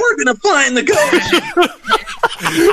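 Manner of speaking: very high cartoon sidekick voice; hammed-up goofy plea to the dog partner; Shaggy.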